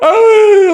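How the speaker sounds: high, loud sobbing cry; high, loud weeping wail; tearful wail. Retold or imitated. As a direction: let out a high crying wail. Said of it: "하면서 울고 있었어".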